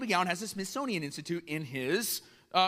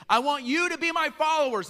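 raised, ringing call to the fishermen; Jesus calling out; 10 semitones higher, 7 dB louder than usual. Retold or imitated. Jesus calling fishermen to follow, loud and high. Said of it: "He's saying".

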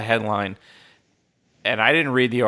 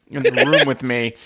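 ordinary talk

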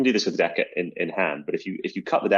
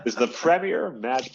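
put-on half-hearted American accent; 4 semitones higher, slower than usual. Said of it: "I didn't really commit to the American accent".

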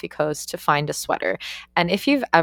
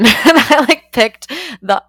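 bursting into laughter, higher and louder; laughing at the memory of the ugly sweater; 9 semitones higher, 9 dB louder, slower than usual. Real, not acted. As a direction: laugh through the words. Laughing while recounting the ugliest sweater.

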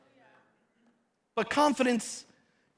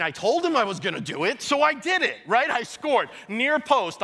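normal talking voice

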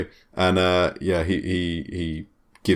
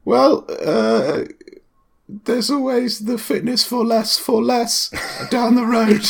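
put-on much higher, hesitant teenage clerk; gym clerk voice; slower than usual. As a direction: squeak out as the nervous acne-ridden clerk.

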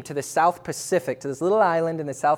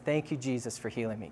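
quiet thanks; 4 semitones lower, 10 dB quieter, faster than usual. Retold or imitated. Woken at night, neck cracks.

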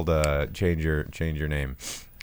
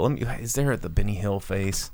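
normal talking voice

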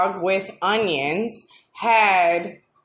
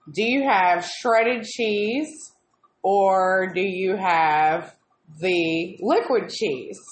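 slow and careful; 4 semitones lower, much slower than usual. Retold or imitated. Slow careful question to cashier.

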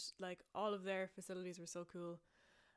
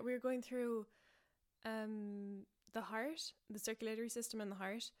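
normal talking voice

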